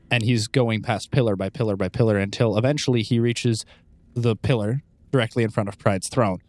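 ordinary talk

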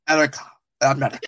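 Erica and America in a thick Russian accent. Shown as a demonstration. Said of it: "You just wanted me to do my Russian accent".

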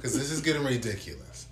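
loud, high, laughing passive-aggression; politely impatient. Acted. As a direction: read it like an annoyed email. Mock passive aggressive email.

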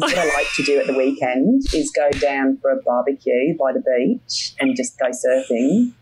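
put-on Australian accent with rising phrase endings. Shown as a demonstration. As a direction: do an Aussie, lifting the ends of phrases. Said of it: "I'm pretty good at Australian".

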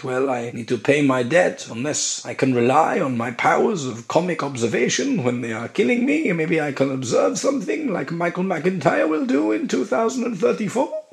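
high, floating confessional voice; put-on struggling blogger. Debtor musing about bailiffs and comedy, put-on high voice. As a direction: let the pitch climb as the musing goes on.

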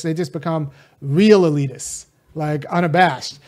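normal talking voice

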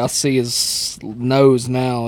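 usual speaking voice

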